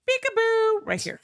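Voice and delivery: high playful peekaboo; sing-song it like a sneaky bear. Retold or imitated. Voicing the bear.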